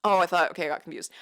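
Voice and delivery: normal talking voice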